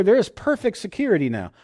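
ordinary talk, nothing marked

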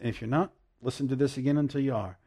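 usual speaking voice

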